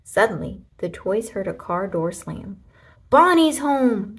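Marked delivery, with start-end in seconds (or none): 3.1-4.2 higher, louder, slow excited call; excited cry. A narrator's reading. Toys call out as Bonnie arrives, loud and excited. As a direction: announce it with joy. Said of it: "they cried".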